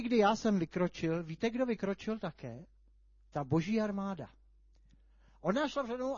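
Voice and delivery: usual speaking voice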